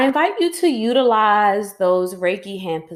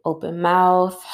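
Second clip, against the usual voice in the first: guided breathing. Acted. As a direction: lead the listener gently through each breath.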